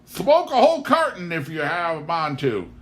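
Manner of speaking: high, loud, jovial boom; hearty farmer waving off the question. Acted. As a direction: bellow it out with good cheer. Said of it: "boomed Pa".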